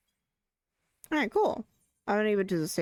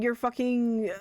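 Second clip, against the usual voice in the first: usual speaking voice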